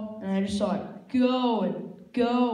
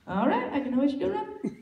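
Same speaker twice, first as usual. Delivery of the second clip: ordinary talk, nothing marked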